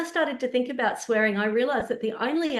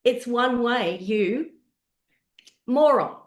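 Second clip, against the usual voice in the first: rising to a loud snap on moron; a stranger's rude jab, louder at the end; retold angry insult; 4 semitones higher, 5 dB louder, slower than usual. Man at car window insulting driver, loud angry jab. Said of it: "he said".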